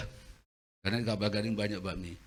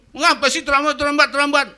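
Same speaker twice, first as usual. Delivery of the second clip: much higher, loud exasperated scolding; annoyed scolding; 13 dB louder than usual. Retold or imitated. Congregant scolding a latecomer, loud and exasperated. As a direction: scold the latecomer, louder each time. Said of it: "kita bilang".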